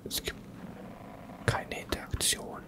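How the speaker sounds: hushed whisper, keeping silent. Real, not acted. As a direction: whisper every word.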